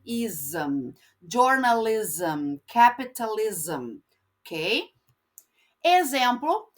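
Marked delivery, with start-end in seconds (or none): 0.0-0.9 ism built from its sounds, I, Z, short A, M; low, careful ism after spelling it; spelled-out ism. Shown as a demonstration. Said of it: "como se fosse um I, Z, A de maçã com M". 1.2-4.0 slow Journalism and capitalism with the ism ending dragged out; slow model words for the class; drawn-out ism. Shown as a demonstration. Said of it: "arrastando esta sílaba".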